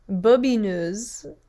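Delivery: "Bobineuse" drawn out, bo-bee-NUHZ; careful, slow French "Bobineuse" with rising end; proper French pronunciation. Shown as a demonstration. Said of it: "look up the proper pronunciation".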